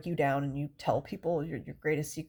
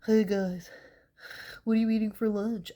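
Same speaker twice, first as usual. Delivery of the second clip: cheerful greeting, a bit louder; sniffly greeting; 3 semitones higher, 3 dB louder than usual. Retold or imitated. Sniffle monster greeting coworkers at lunch, cheerful. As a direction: chirp a hello through a stuffy nose. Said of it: "Just out here all".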